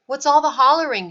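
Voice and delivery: groggy sailor complaining loudly; loud complaint. A narrator's reading. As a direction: gripe about the noise. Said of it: "Halvor complained".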